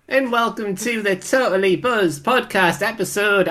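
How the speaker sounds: hammed-up pop star persona opening the show. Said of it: "who has a stellar impression of".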